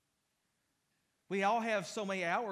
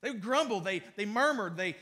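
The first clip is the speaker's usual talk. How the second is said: ordinary delivery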